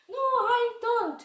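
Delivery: very high, loud, drawn-out no; puppet child refusing. Pretend. Child puppet refusing vegetables, high and drawn out. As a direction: whine it out slowly like a stubborn kid.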